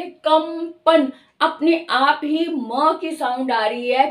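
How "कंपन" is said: कंपन said very slowly, the nasal closing on the lips as म before प; slow demo word. Shown as a demonstration. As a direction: stretch out कंपन so the म sound is heard. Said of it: "तो हम बोलकर देख ले".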